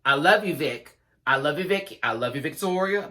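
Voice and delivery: sweet, sing-song affection; mock loving. Real, not acted. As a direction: gush over her before the blow. Repeating affection before criticism.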